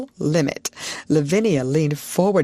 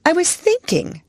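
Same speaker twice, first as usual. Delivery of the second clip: put-on calm young girl, bit raised; girl's voice; 4 dB louder than usual. A narrator's reading. Sarah's voice in story.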